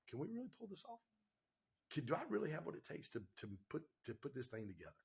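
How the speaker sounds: hushed, worried self-questioning; a soft, uneasy retelling of midnight fear; anxious late-night doubt. Retold or imitated. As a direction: murmur it like lying awake at two A.M. doubting yourself.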